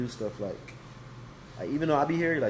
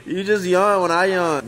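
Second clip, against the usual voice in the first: excited; 7 semitones higher, 10 dB louder, slower than usual. Real, not acted. After the shared yawn.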